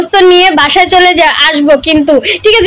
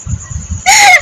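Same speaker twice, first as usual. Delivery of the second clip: shrieking giggle way up high; sudden high-pitched laugh; high squeal of laughter. Real, not acted. Laughing at a comment.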